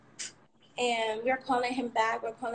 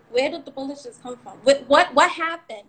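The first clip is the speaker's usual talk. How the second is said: alarmed confusion; 3 semitones higher, 9 dB louder than usual. Retold or imitated. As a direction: act out scrambling to understand what happened. Acting out her panicked thoughts then.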